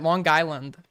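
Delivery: "Long Island" in a New York accent, said as one unit; "Long Island" said the Long Islander way, falling at the end; Long Island pronunciation. Shown as a demonstration. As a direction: show how a local says "Long Island". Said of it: "That's how you say it".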